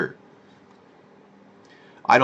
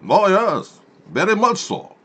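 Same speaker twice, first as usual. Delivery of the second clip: high, hammed-up Russian voice; mock Russian accent; 10 semitones higher, 3 dB louder, slower than usual. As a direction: play a gushing Russian in a falsetto.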